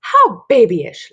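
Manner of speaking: high, loud, slow sneer; mocking laugh. Missus Gobblecracker laughs at Dory, mocking. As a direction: draw it out mockingly. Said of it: "laughs the voice".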